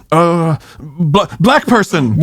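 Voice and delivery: halting, stammered imitation, then blurting it out; groping for words. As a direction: mimic a grandpa hunting slowly for the word. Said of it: "grandpa's trying to find his words".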